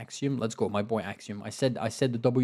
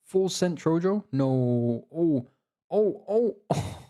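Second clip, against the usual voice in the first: excited shock; 6 semitones higher, 4 dB louder, much slower than usual. Real, not acted. Shocked by sudden attack.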